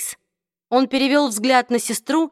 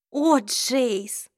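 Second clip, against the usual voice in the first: sad sigh; 3 semitones higher than usual. A narrator's reading. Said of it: "печально произнесла Изабель".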